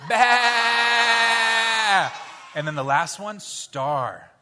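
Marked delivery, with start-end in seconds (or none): 0.1-2.1 loud mock sheep "Bah!"; sheep bleat. Shown as a demonstration. Teacher showing the shepherd sound, loud bleat. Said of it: "And then shepherd".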